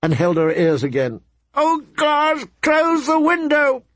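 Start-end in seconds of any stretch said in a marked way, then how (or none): 1.5-3.8 much higher anguished cry; mother screaming over the howling wolves; screaming plea. Acted. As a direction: scream at him to shut it. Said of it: "She screamed".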